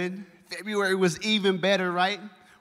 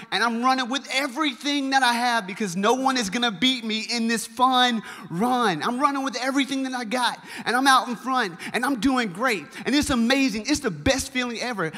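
excited retelling; 6 semitones higher, 4 dB louder than usual. Retold or imitated. Retelling racing out in front.

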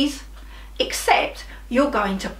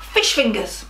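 ordinary delivery

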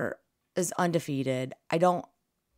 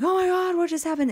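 much higher, loud disbelieving cry; shocked exclamation; 14 semitones higher, 7 dB louder, faster than usual. Retold or imitated. Walking into the bar of Bama fans, high shocked cry. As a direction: shriek it in disbelief. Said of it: "I'm like".

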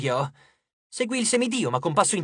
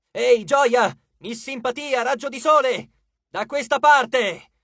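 high-pitched raised calls; calling out taunts; 6 semitones higher, 4 dB louder, slower than usual. A narrator's reading. Annabeth tries to lure the goddess away, calling out. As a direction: shout to draw attention over here. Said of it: "Annabeth gridava".

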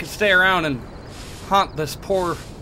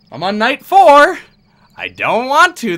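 higher and louder, dragged out whine; loud, slow complaint; 7 semitones higher, 11 dB louder, slower than usual. Real, not acted. Gamer dreading another night, loud slow whine. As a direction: moan it like it's unbearable.

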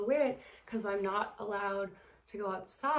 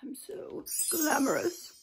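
slow, higher grand-lady voice; mock glamorous lady; 6 semitones higher, slower than usual. Vlogger admiring her outfit, slow mock diva. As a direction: savour every word grandly. Dramatic glam voice.

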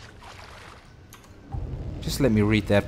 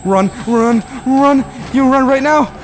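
higher, louder yelled orders to self; frantic escalating run commands; panicked shouting; 9 semitones higher, 5 dB louder than usual. Real, not acted. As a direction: shout at yourself to flee. Fleeing the monster.

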